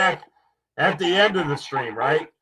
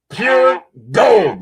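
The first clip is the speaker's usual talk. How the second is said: exclaiming; 5 semitones higher, 7 dB louder than usual. Real, not acted. Host exclaims over gold content.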